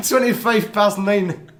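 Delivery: put-on slow, light Glaswegian accent; drawn-out Glaswegian accent, joking. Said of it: "That sounds very Glaswegian".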